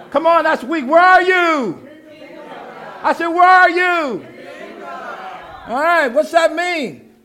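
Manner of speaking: shouted call to the congregation; preaching shout. Real, not acted. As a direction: rouse the room.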